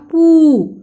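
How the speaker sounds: पू with ऊ held long; pronunciation demo. Shown as a demonstration. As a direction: stretch the vowel of पू. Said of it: "तो उच्चारण हो गया".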